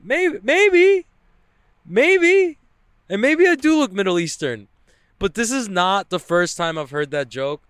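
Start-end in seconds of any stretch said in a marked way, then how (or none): 0.0-4.7 squeaky high drawn-out maybe; mock high musing. Joking about whether [name] sounds Middle Eastern, sung high. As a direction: float it way up high, teasing.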